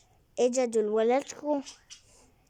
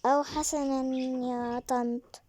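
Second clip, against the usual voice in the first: ordinary talk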